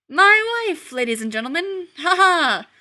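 loud, high forced laughter; hammed-up fake cheer; fake grin laugh. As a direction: cover embarrassment with a showman's laugh. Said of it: "with an enormous fake grin".

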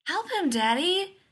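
put-on high, loud, drawn-out little girl plea; pleading child. A narrator's reading. Joe begging Leonard to help a falling man, urgent plea. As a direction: beg Daddy urgently in a child's voice.

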